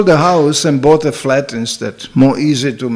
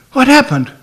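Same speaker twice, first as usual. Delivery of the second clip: higher, louder "What happened?"; raised surprised query; surprised question; 9 semitones higher, 4 dB louder than usual. Retold or imitated. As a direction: ask it startled and urgent. Repeated startled question.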